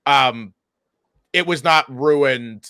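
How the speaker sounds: normal talking voice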